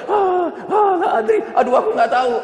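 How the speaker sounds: the lost soul who cannot answer, flustered; panicked stammer. Acted. Unbeliever stammers again. (gagap panik dengan suara jauh lebih tinggi)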